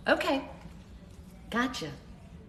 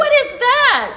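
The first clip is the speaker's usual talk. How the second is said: surprised; 10 dB louder, slower than usual. Real, not acted. Sees a gift on screen.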